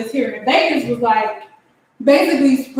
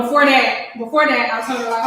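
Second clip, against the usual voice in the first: ordinary talk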